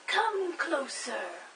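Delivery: slow, drawn-out lure like the wolf; big bad wolf. Blind father lures his son closer, slow wolf-like coaxing.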